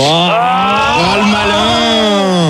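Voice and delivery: traîné et moqueur, très lent; étire le mot pour le taquiner. Real, not acted. Taquinerie après une blague grivoise. (ton taquin et traînant)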